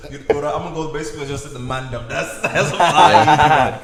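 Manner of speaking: laughing while talking. Real, not acted. Laughing while talking about slang.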